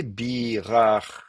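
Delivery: very slow dictation; syllable by syllable. Shown as a demonstration. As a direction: stretch and separate each syllable. Recopies the form aloud.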